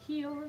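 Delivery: Heel drawn out high; long drawn heel. Shown as a demonstration. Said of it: "so it's H E E E E L R E".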